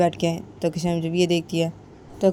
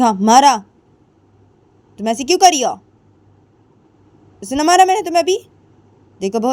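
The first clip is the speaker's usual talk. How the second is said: loud, raised, stern scolding; scolding mother-in-law; 5 semitones higher, 7 dB louder than usual. Acted. Mother-in-law scolds daughter-in-law to eat, loud and stern. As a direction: bark orders to eat at once.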